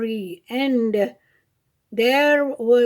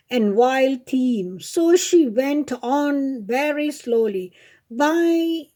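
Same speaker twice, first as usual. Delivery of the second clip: usual speaking voice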